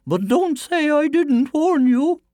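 high, slow, loud woman's voice; high-pitched storybook mother; warning mother. Acted. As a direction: warn him in a drawn-out high voice. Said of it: "said the boy's mother".

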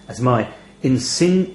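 usual speaking voice

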